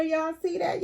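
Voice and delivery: high, eager show-off question; Y'all see that? asked up high; excited. Real, not acted. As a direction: point at the shoes, thrilled. Showing off figure's shoes.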